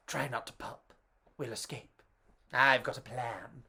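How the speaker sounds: low, very soft secret whisper; hushed conspiring sausage. Acted. Sausage whispering an escape plan to his brother, hushed. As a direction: keep it low so nobody hears.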